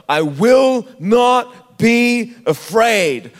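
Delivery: WILL, NOT, BE, AFRAID each punched slowly and loudly; emphatic declaration. Real, not acted. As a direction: declare it word by word, very slow and forceful.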